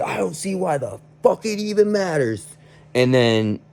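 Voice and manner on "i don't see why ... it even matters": exasperated high-pitched mock rant; freaked-out outburst. Kid freaks out at coworker over a ladder, imitated angry outburst. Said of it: "he just freaked out and he said".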